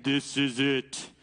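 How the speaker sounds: low, slow, regal voice from heaven; mock Charlton Heston God, low and slow; booming voice of God. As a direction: play God handing down a verdict, slow and deep. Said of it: "the booming voice of, like, God".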